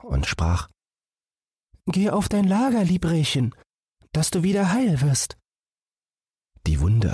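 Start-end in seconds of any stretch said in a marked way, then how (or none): 1.9-5.4 put-on much higher, soothing girl's voice; tender sister. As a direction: comfort the hurt fawn gently. Sister tends wounded fawn.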